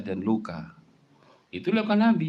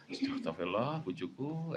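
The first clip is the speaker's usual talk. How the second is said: resigned sigh; 10 dB quieter, slower than usual. Acted. Timid husband sighing at wife.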